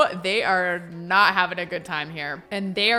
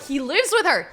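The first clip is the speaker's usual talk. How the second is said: high, raised exclamation; excited realization; 7 semitones higher, 4 dB louder than usual. Real, not acted. Player realizing how he'll seize her property, high excited exclamation. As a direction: blurt it out as a discovery. Realization, emphatic first take.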